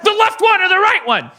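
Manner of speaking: very high, loud laughing comeback; laughing retort. Retold or imitated. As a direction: fire back a joke, cracking up. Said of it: "And I'm like".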